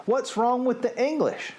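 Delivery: high, affronted question; mildly angry. A narrator's reading. Sophie defending the English to the giant, mild high indignation. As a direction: patriotic pride bruised, pitch up. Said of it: "she became quite angry".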